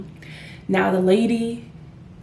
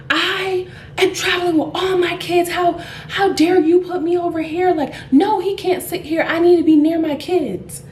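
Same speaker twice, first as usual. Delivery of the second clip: high-pitched mock outrage; flipping out; 9 semitones higher, 3 dB louder than usual. Mother refusing a man beside her, indignant outburst. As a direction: imitate an irate mother protesting, pitch held high. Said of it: "flipped out".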